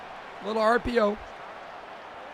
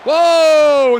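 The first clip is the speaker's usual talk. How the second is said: higher, much louder startled cry; loud startled whoa; 6 semitones higher, 13 dB louder than usual. Real, not acted. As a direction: burst out in shock. Startled at a player's play.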